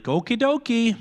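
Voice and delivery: a mom's cheery Minnesota goodbye on the phone. Retold or imitated. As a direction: sing it out like a mom hanging up the phone. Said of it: "my mom always ended the phone conversation with".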